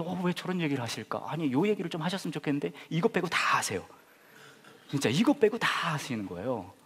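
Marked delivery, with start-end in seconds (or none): 0.0-3.9 inner grumbling. Retold or imitated. Pastor voicing his inner frustration.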